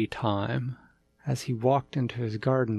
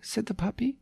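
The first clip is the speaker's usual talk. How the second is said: usual speaking voice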